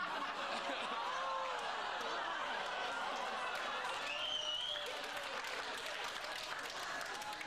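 long, high helpless cackle of laughter; real high-pitched belly laugh; big laugh. Real, not acted. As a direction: crack up completely at the joke. Host cracks up at shag book.

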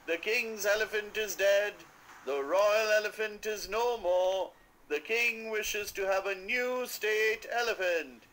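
high, loud town crier call; loud announcement. A narrator's reading. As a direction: proclaim it to the whole street. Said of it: "announcing loudly in the streets".

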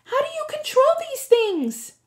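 high, loud desperate cry; Annabeth's frantic shout. A narrator's reading. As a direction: shout it over the rushing. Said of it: "Annabeth shouted".

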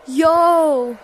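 loud startled yo; surprised shout. Real, not acted. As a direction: blurt it out in surprise. Surprised by cosmetics.

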